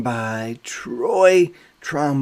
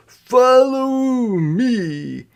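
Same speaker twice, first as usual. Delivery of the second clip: higher, slow bandleader call; drawn-out, higher call to follow; big brother leading; 11 semitones higher, 4 dB louder, much slower than usual. Retold or imitated. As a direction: lead the band like the big brother. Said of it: "James would say".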